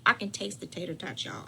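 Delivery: ordinary talk, nothing marked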